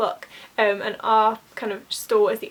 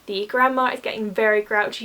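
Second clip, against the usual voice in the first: ordinary talk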